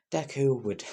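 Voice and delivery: ordinary delivery, nothing marked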